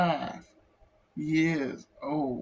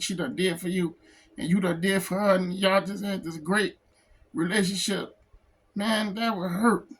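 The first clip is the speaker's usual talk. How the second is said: ordinary talk, nothing marked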